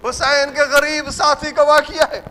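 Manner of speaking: ordinary talk, nothing marked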